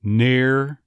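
Near with a hard Texas R. Shown as a demonstration. An accent coach demoing Near in Texas style. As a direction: lean on the R in Near. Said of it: "notice that hard R".

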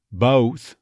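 "Both" as "buh-oath"; neutralized O. Shown as a demonstration. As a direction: start the O of "Both" on a schwa. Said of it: "Neutralization of the first half of the O diphthong".

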